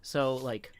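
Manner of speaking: ordinary delivery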